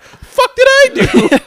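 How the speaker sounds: very high, loud, drawn-out squawk; indignant protest. Retold or imitated. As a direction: squawk it like the wrongly accused. Acts Jeff's indignant protest.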